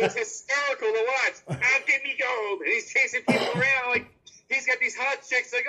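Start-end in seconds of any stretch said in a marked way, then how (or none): 1.6-2.6 leprechaun voice. Retold or imitated.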